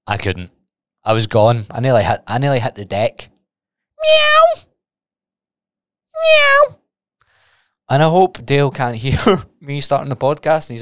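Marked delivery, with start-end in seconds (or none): 4.0-4.6 very high, loud playful meow. Pretend. Meowing into the podcast while Dale is outside, high loud meow. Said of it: "and I'm meowing". 6.1-6.8 very high, loud playful meow. Pretend. Meowing into the podcast while Dale is outside, high loud meow. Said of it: "and I'm meowing".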